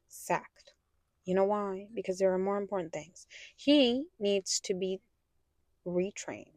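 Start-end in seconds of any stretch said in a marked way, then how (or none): none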